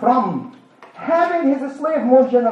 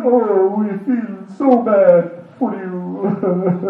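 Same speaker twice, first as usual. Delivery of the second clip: mock pity; 3 semitones lower, much slower than usual. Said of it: "very sad, feeling a lot of pity".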